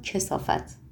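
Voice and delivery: hissed under the breath; whispered insult. Acted. Margarita turns back to the stranger, whispered insult. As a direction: spit the word out in a whisper.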